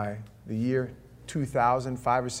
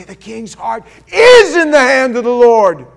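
slow, loud, high proclamation; full-force pulpit cry of the verse; preaching shout; 9 semitones higher, 17 dB louder, slower than usual. Real, not acted. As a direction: thunder the verse out.

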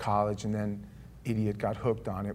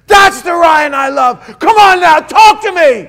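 much higher, much louder, slow at first; tearful joyful shout; 22 dB louder, slower than usual. Retold or imitated. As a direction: cry it out in relief and joy. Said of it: "tears now running down his eye".

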